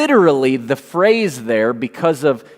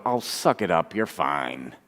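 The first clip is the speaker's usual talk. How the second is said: dismissive; 8 semitones lower, 9 dB quieter than usual. Retold or imitated. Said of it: "inside you go".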